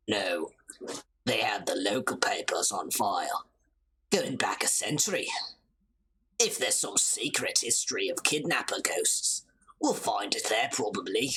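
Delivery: low murmured character voice; low-voiced game character. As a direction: drop right down for a laid-back reply.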